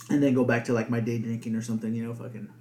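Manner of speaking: usual speaking voice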